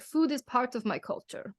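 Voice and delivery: bad, lightly put-on Armenian accent; weak Armenian accent that drifts Russian. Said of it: "my horrible impression of my mother's impression of her grandfather's Armenian accent".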